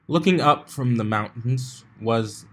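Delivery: usual speaking voice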